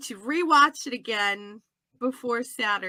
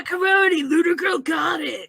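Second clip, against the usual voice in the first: higher, shouted cheer; playful exclamation; 4 semitones higher, 5 dB louder than usual. Acted. Co-host cheering on the Foley artist joke, excited. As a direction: call it out gleefully.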